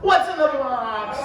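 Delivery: high, slow, strained movie wail; a deliberately bad Brad Pitt. Comic doing a terrible Brad Pitt for the crowd, slow strained wail. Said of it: "my worst Brad Pitt impression".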